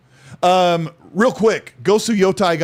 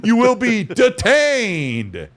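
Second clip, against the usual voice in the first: higher, very slow pronouncement; mock court official; 6 semitones higher, much slower than usual. Co-host echoing the court warning, mock stern. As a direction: stretch "detained" like an official threat.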